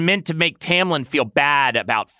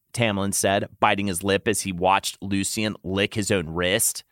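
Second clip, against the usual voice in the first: usual speaking voice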